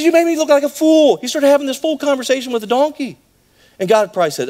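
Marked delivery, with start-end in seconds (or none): 0.0-1.2 indignant Balaam. Retold or imitated. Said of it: "He says".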